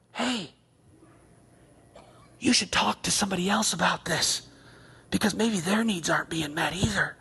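a hint of a sly tempter; lightly voiced tempting suggestion; low coaxing tempter; Satan whispering. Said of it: "he would whisper".

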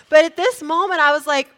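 ordinary talk

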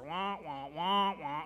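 lightly muffled wah-wah trombone-like droning; Charlie Brown teacher. Retold or imitated. Preacher imitating the cartoon teacher for the congregation, droning wah wah. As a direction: mimic the garbled adult voice from the cartoon. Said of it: "I think of the Charlie Brown teacher".

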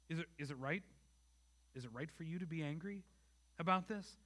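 God's soft, gracious question to angry Jonah. Retold or imitated. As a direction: ask it as a patient, loving father would. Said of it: "he responds to Jonah graciously".

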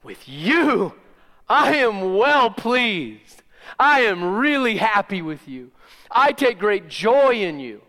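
God's happy father voice, ringing out over creation; resonating voice of God. Pretend. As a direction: proclaim it as a proud father delighting in his son. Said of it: "this resonating voice in all of creation saying".

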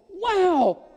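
high, shouted wow of astonishment; amazed disciple. Acted. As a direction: cry out in sheer wonder. Plays disciples seeing Jesus on water.

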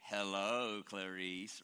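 low, soft, creepy Hello Clarice; a menacing low murmur like Lecter; Hannibal Lecter impression. Pastor quoting the villain's famous line, low and creepy. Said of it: "And what does he say?".

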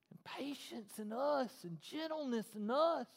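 whispered list. Acted. Pastor to the men, a whisper. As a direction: let it fall to a whisper. Listing fruits of the spirit.

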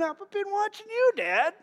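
put-on light high kid voice, innocent; child's voice. Son answering his dad, lightly voiced small child. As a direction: say it like a proud little boy. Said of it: "country song".

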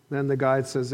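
ordinary talk

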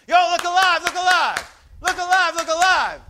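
loud, high call of look alive over and over; shouted coach's call from the dugout; coach yelling from the dugout. Retold or imitated. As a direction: bark it across the field to wake the players up. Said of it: "would all of a sudden just yell out from the dugout".

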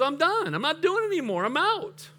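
fed-up king quitting. Retold or imitated. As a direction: play the king giving up, climbing in pitch. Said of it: "And finally he goes".

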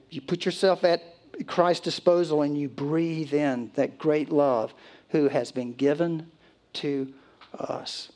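ordinary talk, nothing marked

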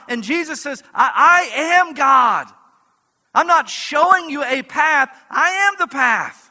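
loud, high, driving sermon peak; fiery pulpit proclamation; preaching at full force. Acted. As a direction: preach it out to the whole room. Climactic preaching.

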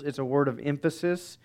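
ordinary delivery

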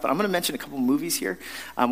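usual speaking voice

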